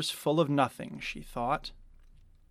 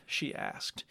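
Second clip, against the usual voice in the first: plain reading voice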